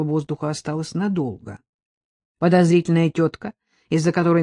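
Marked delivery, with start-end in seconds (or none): none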